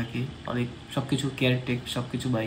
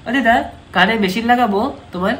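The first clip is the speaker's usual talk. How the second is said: loud and raised for a deaf listener; to someone hard of hearing; 7 semitones higher, 9 dB louder than usual. Real, not acted. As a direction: call it out clearly so she hears. Asking deaf grandma about hearing aid.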